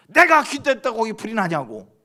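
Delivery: sudden loud, high outburst; acted indignant retort. Pastor denies the bar owner's accusation in court, loud indignant protest. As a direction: burst out like an accused man protesting.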